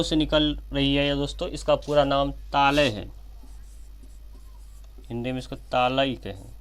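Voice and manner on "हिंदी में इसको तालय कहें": ordinary talk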